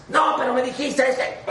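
hurt brother. Retold or imitated. Brother hurt, whining complaint.

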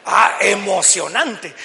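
loud, drawn-out exclamation of awe; acted thrill. Preacher acting out the thrill of crossing the sea, excited. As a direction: stretch it out loudly, hammed-up excitement.

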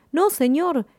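high-pitched cry of alarm; alarmed protest. A narrator's reading. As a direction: plead sharply to stop the captain. Man pleads as gun is raised.

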